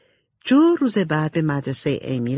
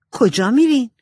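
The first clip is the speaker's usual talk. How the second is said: Amy voice; 8 semitones higher, 5 dB louder than usual. A narrator's reading. Amy asks her sisters.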